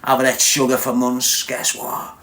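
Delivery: ordinary talk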